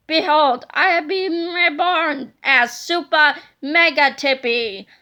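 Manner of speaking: loud, slow gloating decree; grand villain proclamation. Acted. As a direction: announce yourself like a giant tyrant.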